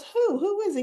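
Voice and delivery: high-pitched nosy imitation; prying question. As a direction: act out a wife demanding to know. Wife questioning husband in retelling.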